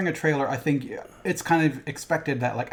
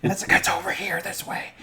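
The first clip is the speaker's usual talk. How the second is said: noisy whisper; 7 semitones higher than usual. Retold or imitated. Latecomers with flashlight finding seats, noisy whisper. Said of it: "you know that noisy quiet".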